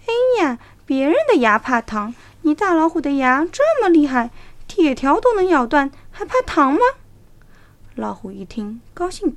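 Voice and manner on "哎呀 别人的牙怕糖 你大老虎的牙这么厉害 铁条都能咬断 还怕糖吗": wheedling fox. A narrator's reading. Fox persuades tiger.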